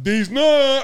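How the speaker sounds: high, loud prank punchline; imitated gotcha shout. Coworker springing his joke at work, mock shout. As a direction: blurt it out as the coworker's loud taunt.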